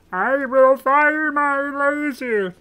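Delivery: high, loud, drawn-out cartoon villain; very high, strained cartoon voice; Marvin the Martian. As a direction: belt out a slow alien threat. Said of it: "Marvin the Martian".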